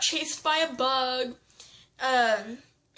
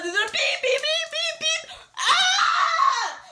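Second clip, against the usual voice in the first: very high squeaky beeps ending in a laughing shriek; imitating horn; 13 semitones higher, 3 dB louder, much slower than usual. Friend retelling the truck honking, high beeps into a laughing scream. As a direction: squeal the honks then scream. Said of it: "she starts going all that".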